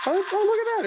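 high, delighted gasp of surprise; suddenly amazed, voice jumping up; surprised. Real, not acted. Toy car spins surprisingly.

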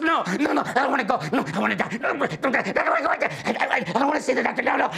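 high, loud panicked begging with a laugh; hammed-up frantic whining refusal; mock terrified pleading. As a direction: beg like a patient dreading surgery. Patients pleading not to see doctor.

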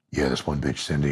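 low, soft, gravel-free deadpan; gruff Batman. Retold or imitated. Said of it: "He's like".

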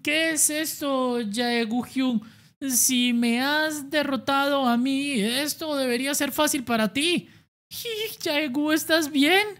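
high, worried questioning; high anxious friend at ringside; pleading friend. Acted. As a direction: beg him to listen and fight smart.

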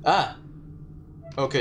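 ordinary delivery

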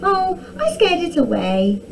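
high, mildly gleeful princess; cackled boast. A narrator's reading. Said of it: "cackled Arabella".

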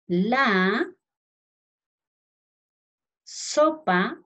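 words set apart by long pauses, spelling cues tacked on; teacher dictating a question; slow dictation. Shown as a demonstration. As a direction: dictate slowly so children can write each word. Dictating instruction word by word.

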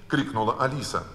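usual speaking voice

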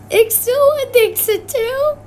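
loud, high and slow; high excited exclaim. Real, not acted. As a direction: call it out excitedly.

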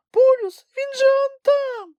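very high, squeaky little creature voice; a tiny animal piping up in excitement; Kulka exclaiming. A narrator's reading. Kulka tells Grampus they are almost there, squeaky excited cry.

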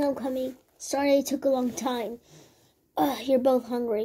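usual speaking voice

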